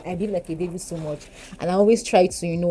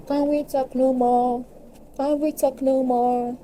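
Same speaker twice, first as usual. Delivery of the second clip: high, slow whiny "Can we talk no more?"; mock plea; 7 semitones higher, slower than usual. Begging the aeroplane to stop, slow high mock whine. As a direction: plead playfully at the noise. Complaining to the plane.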